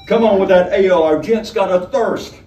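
loud, high bellow over the shoulder. A narrator's reading. Honeybell bellowing to the barman for ale. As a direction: yell the order across the tavern. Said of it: "she bellowed over her shoulder".

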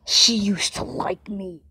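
hushed whisper to viewers. Real, not acted. Player whispering a secret to his viewers. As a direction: lean in and whisper.

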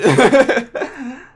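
real laugh, high and loud. Real, not acted. Gamer laughing at the dead crew, loud amused laugh.

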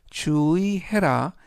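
ordinary delivery

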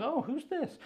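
high, soft, surprised little voice; curious YouTube. Acted. YouTube noticing a well-liked video, curious and high. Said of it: "the more YouTube goes".

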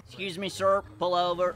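cop command. Pretend. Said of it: "I'm a cop".

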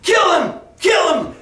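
high, punched crowd chant; acted shouts demanding death; mob shouting. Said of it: "were shouting".

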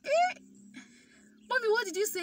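high, loud stunned "Eh?"; can't believe what she just heard; shocked disbelief. Retold or imitated. Stunned by the news.

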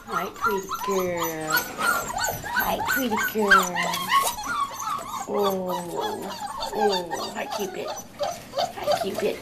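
very high squeaky puppy talk. Real, not acted. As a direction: squeak adoringly at each pup. Greeting puppies.

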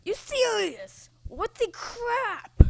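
high, loud outraged yell. Real, not acted. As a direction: shout in disbelief at the game. Outraged at failed jump.

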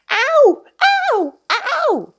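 high, loud ows; pained yelps. Acted. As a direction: yelp with each hit. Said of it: "screamed Stubbs".

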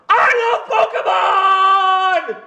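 full-on laughing scream, very high and loud. Real, not acted. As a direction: scream it out with everything you've got. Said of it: "we're gonna scream it as loud as you can".

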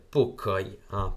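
normal talking voice

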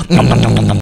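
loud, low gobbling noms; mock munching noises. Player who loves chicken, pretend loud munching. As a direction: pretend to wolf down food.

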